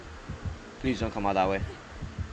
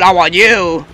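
mock killer voice; 7 semitones higher, 12 dB louder, slower than usual. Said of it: "Oh, he said".

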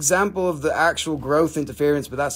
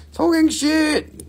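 mock echo; 4 dB louder, slower than usual.

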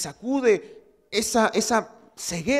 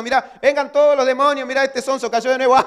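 gloating devil; 5 semitones higher, 7 dB louder than usual. Acted. Devil calls demons to mock.

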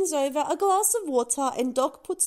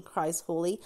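usual speaking voice

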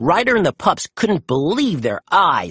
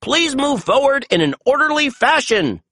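loudspeaker announcement; 10 semitones higher than usual. A narrator's reading. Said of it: "his loudspeaker".

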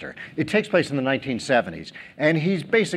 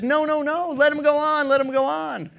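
eager producer; 9 semitones higher than usual. Retold or imitated. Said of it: "steps forward and says".

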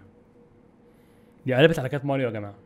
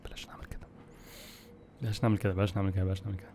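hushed, much softer, dreading it; fearful whisper; 9 semitones lower, 13 dB quieter than usual. Real, not acted. Gamer softly begging himself not to try the jump.

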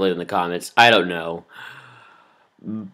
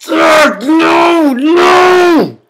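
high, loud cry of dismay; real despairing shout; anguished yell; 12 semitones higher, 5 dB louder than usual. Real, not acted. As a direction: yell no as it all goes wrong. Game character falls, dismayed.

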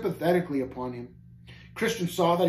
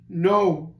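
loud, higher, groaning refusal; groaned; 4 semitones higher, 6 dB louder than usual. A narrator's reading. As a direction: groan it out despairingly. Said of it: "groaned he".

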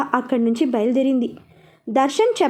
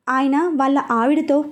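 plain reading voice

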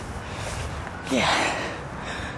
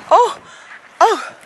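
ordinary delivery

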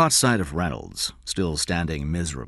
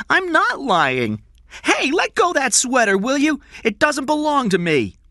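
put-on very high, loud child's voice; protesting boy; 8 dB louder than usual. A narrator's reading. Grabbed boy denies lying to the kidnapper, protesting. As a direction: protest loudly in a high boyish pitch.

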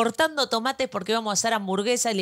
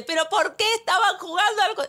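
high-pitched, insistent mock yell; worked-up mother's raised-pitch demand; retold scolding shout; 10 semitones higher than usual. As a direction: keep berating over the husband. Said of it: "yo gritaba".

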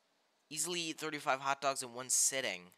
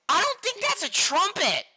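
very high, loud yell of disbelief; shouted objection; 11 dB louder than usual. Real, not acted. Streamer objecting to a trumpet card, shouted objection. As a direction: yell it out high, outraged at the mistake.

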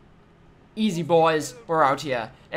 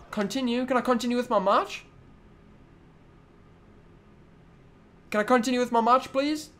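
exasperated plea; 5 semitones higher than usual. Real, not acted. Player asking to continue his match, exasperated. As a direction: ask with pitch raised, climbing on "please".

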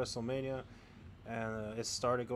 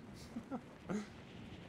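breathy laugh; 9 semitones higher, 8 dB quieter than usual. Real, not acted. Laughs at his own story.

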